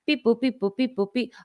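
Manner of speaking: high sing-song beep-boop noises; imitated computer beeps. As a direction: mimic keys being tapped on a computer. Imitating clerk's computer typing.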